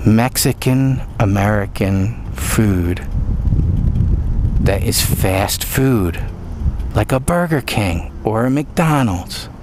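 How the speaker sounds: slow, drawn-out build to the reveal; slow dramatic reveal. Shown as a demonstration. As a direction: draw out the big reveal slowly. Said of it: "Wait for it".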